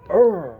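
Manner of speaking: loud high grunt of pain; mock groan. Eren taking a shot, a loud mock groan. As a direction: imitate a hit groan. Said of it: "Eren's just there like".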